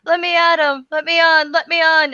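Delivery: itching to get into the debate; eager to jump in. Retold or imitated. Streamer mimicking his eager co-host, itching to jump in. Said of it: "sitting there going".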